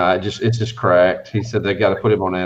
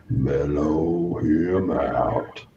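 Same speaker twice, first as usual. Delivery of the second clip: very slow, drawn-out mellow; slow mellow drawl; 4 semitones lower, much slower than usual. Shown as a demonstration. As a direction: stretch out "Mellow him out" lazily.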